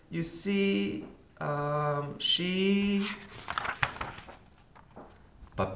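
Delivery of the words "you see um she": high, halting attempt to explain; stammering. A narrator's reading. Arthur explaining his sister to friends, stammering. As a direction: trail through it in awkward fragments. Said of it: "Arthur stammered".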